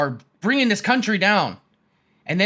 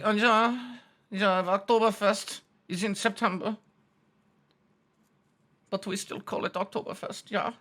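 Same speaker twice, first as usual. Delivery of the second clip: very soft, put-on German accent; hushed comic German voice; 7 dB quieter than usual. Germans chiming in about Oktoberfest, German accent. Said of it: "And then the Germans will be like".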